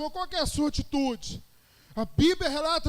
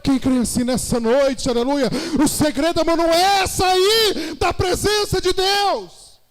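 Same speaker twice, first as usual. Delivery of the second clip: impassioned Pentecostal shout; full-force preaching; 4 semitones higher, 8 dB louder than usual. Real, not acted. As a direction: drive the point home to the congregation.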